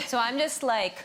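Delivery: ordinary delivery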